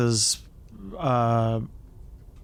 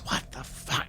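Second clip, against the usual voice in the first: hushed shocked whisper; 9 dB quieter than usual. Acted. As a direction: breathe it out in disbelief. Character shocked at dead bodies.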